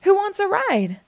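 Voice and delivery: high-pitched friendly offer; small truck's high voice; little blue truck. A narrator's reading. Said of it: "said Blue".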